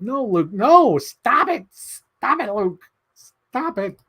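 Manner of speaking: high, pleading scold at Luke; mock scolding. Teasing a friend, a high mock scold. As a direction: playfully tell Luke to stop.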